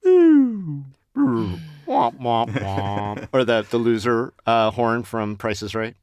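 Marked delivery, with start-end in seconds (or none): 0.1-3.3 vocal sad trombone; sad trombone womp. Acted. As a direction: sing a falling womp womp. Sad trombone sound effect.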